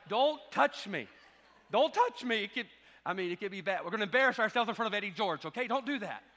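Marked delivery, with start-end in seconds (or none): 0.1-2.4 louder and higher, slow pleading repeat; hammed-up overfull panic; stuck in traffic, loudly begging not to be touched; mock alarm. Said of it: "I'm like".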